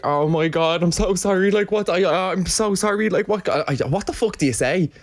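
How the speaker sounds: retold mortified caller apologising; shocked. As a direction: stammer out sorry in shock. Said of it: "I was like".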